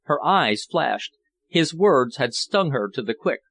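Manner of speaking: ordinary delivery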